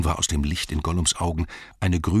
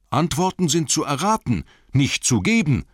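high, raised, insistent answer; firm retort; 10 semitones higher, 5 dB louder than usual. A narrator's reading. Bilbo refuses to give away the answer, firm retort. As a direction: state the rule, annoyed at the delay.

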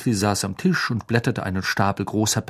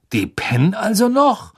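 high, indignant grumble; put-out, higher-pitched grumbling; 11 semitones higher, 5 dB louder than usual. A narrator's reading. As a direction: grumble in disbelief, pitch pushed up. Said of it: "grummelte Fred".

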